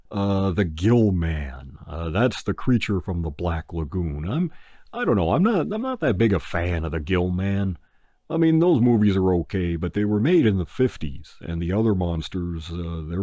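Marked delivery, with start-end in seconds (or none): none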